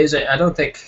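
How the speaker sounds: ordinary talk, nothing marked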